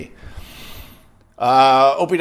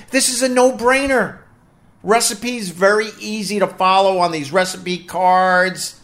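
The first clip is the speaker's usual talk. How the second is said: ad read; 4 semitones higher than usual. Acted. Reads sponsor ad for HelloFresh.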